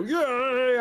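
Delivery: high mock puppet cheer; Muppet imitation. Friend teasing the player's cheer, imitating a Muppet. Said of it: "you sound like a Muppet".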